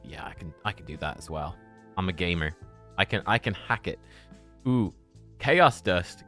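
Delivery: normal talking voice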